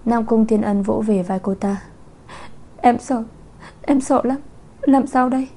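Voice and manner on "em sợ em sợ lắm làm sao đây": higher, lightly tearful pleading voice; a mild, understated weep, begging him; tearful plea. Acted. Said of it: "Chu Chu khóc như mưa". (giọng nức nở nhẹ, cao giọng van nài)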